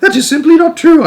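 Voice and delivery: high, loud indignant cry; boy refusing the truth, loud and high; cried out. A narrator's reading. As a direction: burst out in protest. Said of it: "I cried".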